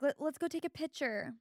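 "picture" said as "pitcher"; Utah pronunciation. Retold or imitated. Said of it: "They'll say, like".

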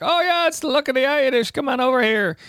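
high, hammed-up Irish charm, beckoning visitors over; mock Irish tourist welcome. Irish locals calling tourists over, hammed-up high voice. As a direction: play a cheesy Irish host luring tourists in. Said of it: "they're like".

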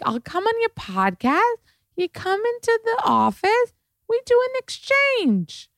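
put-on very high old-lady voice; elderly dermatologist. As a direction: imitate an old woman inviting someone over. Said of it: "the eighty-year-old derm who was like".

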